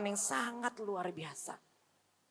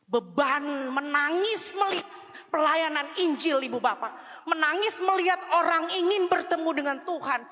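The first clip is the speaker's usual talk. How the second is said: louder, higher, slowed preaching cry; preaching at full force; 6 semitones higher, 5 dB louder than usual. Acted. Preacher stirring the congregation, in full-force preaching. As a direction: preach it out, climbing in pitch.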